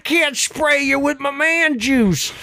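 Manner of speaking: hammed-up hillbilly spat; bickering hillbillies. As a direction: snap at your spouse.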